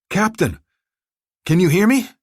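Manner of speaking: high, loud urgent call. A narrator's reading. Commando urgently calling to the wounded captain, high and loud. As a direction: rouse him, call out loud.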